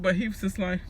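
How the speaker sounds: ordinary talk, nothing marked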